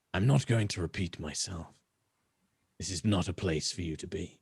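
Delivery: lightly voiced rasping elf; low cold warning from a stranger. Elf warns again.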